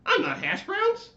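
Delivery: very high, loud wail, slow; crying latke. Acted. Latke insulted by the lights, wailing high. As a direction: protest in a squeal. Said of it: "cried the latke".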